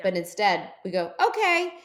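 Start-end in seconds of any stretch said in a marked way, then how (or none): 1.2-1.7 high, loud, mock-chirpy agreement; naively eager. Retelling saying yes to a stranger's ride, naively eager. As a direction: agree far too readily, hammed up.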